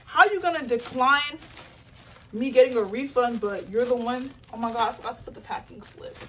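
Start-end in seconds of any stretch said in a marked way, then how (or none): 0.1-4.3 retold indignant demand. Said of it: "I was pissed".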